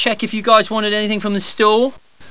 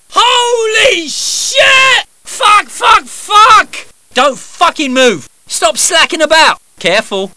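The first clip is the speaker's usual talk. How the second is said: frantic yelled combat orders, higher and louder; battle shouting; 10 semitones higher, 5 dB louder, much slower than usual. Acted. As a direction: bellow over gunfire. Combat barks under fire.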